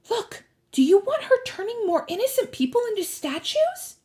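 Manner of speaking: put-on high-pitched, impatient girl; high, challenging question; insistent young girl. A narrator's reading. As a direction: press the argument urgently. Annabeth voiced urging Percy.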